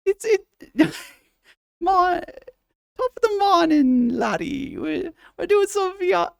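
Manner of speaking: high falsetto Irish with laughter; Irish accent. Shown as a demonstration. Streamer greets chat as a giggling leprechaun in Irish. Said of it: "I wanted to get it right so it sounds funny".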